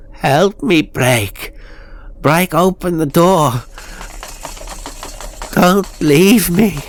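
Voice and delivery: high, loud, frantic begging; desperate plea. Acted. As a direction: cry out as if trapped and terrified. Trapped doll pleading desperately.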